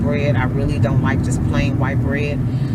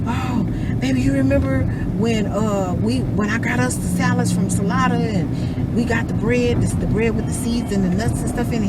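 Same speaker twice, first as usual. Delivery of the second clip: sweet coaxing; 6 semitones higher than usual. Retold or imitated. Sweetly coaxing husband retold.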